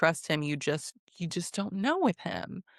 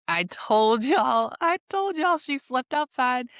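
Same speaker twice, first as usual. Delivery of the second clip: higher, crowing with vindication; drawn out, rising to a high pitch; triumphant I-told-you-so; 6 semitones higher than usual. Real, not acted. Commentator telling viewers they called it right, triumphant. Crowing that their prediction came true.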